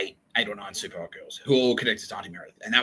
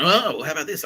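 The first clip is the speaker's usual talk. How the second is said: retold taunt, pitched up and louder; mock-casual counter-offer; 8 semitones higher, 7 dB louder than usual. Springing a surprise on captors, mock-casual taunt. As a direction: toss it out louder and higher.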